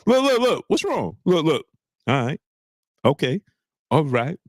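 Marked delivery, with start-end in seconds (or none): none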